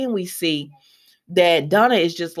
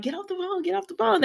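high, softly squealed urging; excited mock urging, pitched way up but softer; retold excitement; 11 semitones higher, 6 dB quieter, faster than usual. Couple bursting to share news with daughter, retold excitement. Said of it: "They're so excited".

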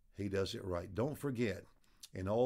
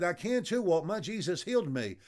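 insistent protest; 6 semitones higher, 4 dB louder than usual. Retold or imitated. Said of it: "He said".

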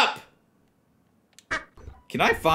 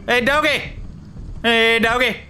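calling out; 10 semitones higher, faster than usual. Real, not acted. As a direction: call the dog eagerly. Calling a dog.

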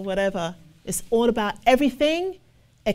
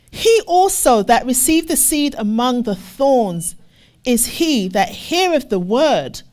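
loud, slow, weighty reading of the verse; emphatic scripture reading; 5 dB louder than usual. Acted. Preacher proclaiming the verse to the church, loud and slow.

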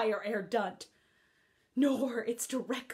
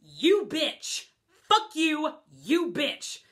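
imitating her yelling; 4 semitones higher, 6 dB louder, slower than usual. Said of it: "she's all like".

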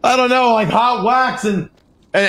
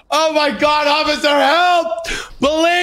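crying for help; 4 semitones higher than usual. Pretend. Captive yelling for officer's help, high mock-panicked cry. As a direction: wail for the police in mock panic.

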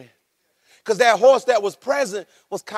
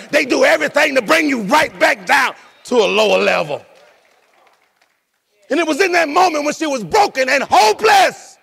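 preaching at full force; 4 semitones higher than usual. Acted. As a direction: preach it to the rafters. Preaching builds up.

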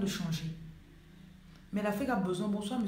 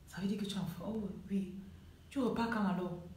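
intrigued acquaintance; 6 dB quieter than usual. Acted. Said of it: "On commence à te regarder autrement".

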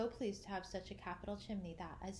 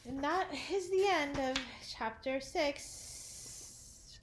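slow, raised sign-off; closing announcement; 6 semitones higher, 6 dB louder, much slower than usual. Real, not acted. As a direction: wrap up the chapter grandly.

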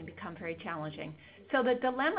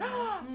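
sharp high gasp of sudden realization; real gasp; 14 semitones higher than usual. Real, not acted. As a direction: catch your breath, caught off guard. Realizes they ran over time.

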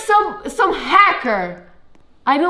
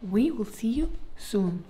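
soft hushed whisper; 2 semitones lower, 11 dB quieter than usual. Real, not acted. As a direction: whisper the goodbye. Whispered sign-off.

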